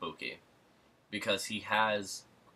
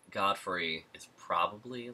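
ordinary delivery

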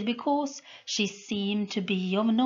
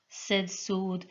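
usual speaking voice

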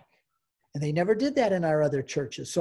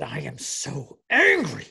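mock angry outburst; 3 semitones lower, 4 dB louder, slower than usual. Husband imitating his wife leaving Mass, mock fury. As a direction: imitate clenched-fist fury, bursting on the last word. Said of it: "clenched her little fists".

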